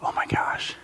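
whispered frustration; exasperated whisper. Retold or imitated. Driver missing an exit whispers in frustration. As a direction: breathe it out in annoyance. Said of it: "you're like".

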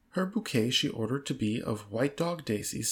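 usual speaking voice

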